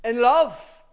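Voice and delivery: In love called out loud; emphatic love. Shown as a demonstration. Preacher driving home love to the church. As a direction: ring out the word love. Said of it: "is what Paul's putting the emphasis on".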